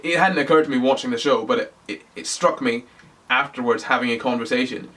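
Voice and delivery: usual speaking voice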